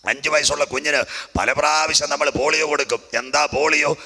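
high, driving sermon delivery; fervent preaching. Real, not acted. Preacher warning believers that the Lord is coming, fervent preaching. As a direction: preach it at full force to the crowd.

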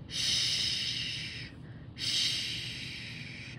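long, hushing "sh"; SH sound. Shown as a demonstration. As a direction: draw out "sh" as pure breath. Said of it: "I have my S H here".

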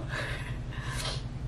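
quiet unvoiced laugh under the breath; soft breathy laugh. Real, not acted. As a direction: laugh softly to yourself. Tearful laugh after sappy thanks.

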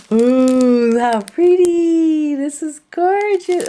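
high loud slow cooing; gushing. Real, not acted. Crafter showing viewers her new trims, high delighted cooing. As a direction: coo over it in awe.

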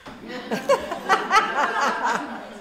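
long, high burst of laughter; real high-pitched laughter; hearty laugh. Real, not acted. Laughing at student's slip.

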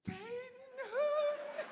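very high, loud drawn-out yell; crowd's cheering shout. Retold or imitated. Gang of friends spots the lost boy, high hollered greeting. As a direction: imitate a whole gang hollering a name.

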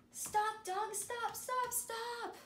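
high, emphatic calls of stop; excited storybook stop; urgent stop. Acted. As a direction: shout a playful warning to halt. Red light, dogs must stop.

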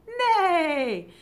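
high loud whinnying neigh; horse neigh. Shown as a demonstration. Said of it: "Can you make a horse sound?".

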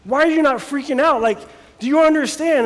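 perplexed servant. Retold or imitated. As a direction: demand it in a frightened disbelief. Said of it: "young servant is just, like, perplexed".

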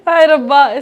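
loud dramatic oh my god; dramatic loud aunty. Real, not acted. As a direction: cry out hai rabba loudly. Dramatic exclamation about crying easily.

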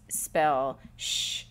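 clear, firm dictation prompt; teacher dictating. Shown as a demonstration. Teacher dictating a sound to students, clear and firm. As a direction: call out the sound for the class. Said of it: "say to the students".

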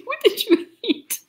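high, giggly repeat of the question; laughing echo. Real, not acted. Echoing friend's question to the spider, laughing and high. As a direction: squeak it through laughter.

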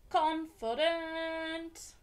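confident stretched very slowly; drawn-out confident. Real, not acted. Reader dwelling on Anjali feeling confident. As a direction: savour the word confident.

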